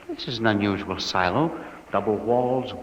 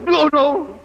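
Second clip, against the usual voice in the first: higher, frightened exclamations; panicky, raised voice; alarmed; 7 dB louder than usual. Acted. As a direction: recoil in horror at a discovery. Discovers silent people around him.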